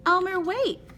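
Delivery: high, loud pleading call. A narrator's reading. Adeline begging Elmer not to leave yet, high loud plea. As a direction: call after him, begging him to stay. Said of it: "pleaded, pleaded Adeline".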